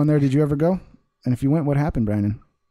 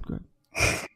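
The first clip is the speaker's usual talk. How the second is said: whisper; 9 dB quieter than usual. Real, not acted. Host yielding to his guest, whispered. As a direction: go ahead whispered, no voice at all.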